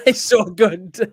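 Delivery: slow words through laughter; laughing. Real, not acted. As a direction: laugh while saying it. Laughing after the joke.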